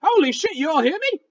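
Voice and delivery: high, squeaky critter rambling in disbelief; talking raccoon, amazed. Acted. Raccoon realizing the two-legs can hear him, high and amazed.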